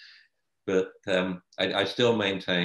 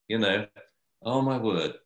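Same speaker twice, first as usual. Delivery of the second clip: ordinary talk, nothing marked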